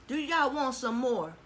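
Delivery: high, loud, slow teasing offer; loud playful offer. Real, not acted. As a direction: call it out playfully. Offering food to camera.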